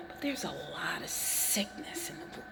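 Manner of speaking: hushed whisper aside. Real, not acted. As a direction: drop to a whisper. Sudden aside dropped to whisper.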